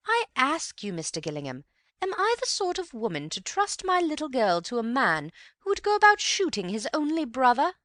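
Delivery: high and loud outraged society mother; indignant mother. A narrator's reading. Mother demands Antony judge her, high and outraged.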